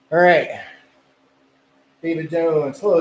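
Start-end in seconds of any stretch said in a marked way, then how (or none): none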